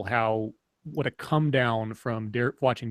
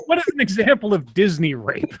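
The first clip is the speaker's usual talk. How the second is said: high laughing question; 8 semitones higher, 5 dB louder than usual. Real, not acted. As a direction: ask it while cracking up.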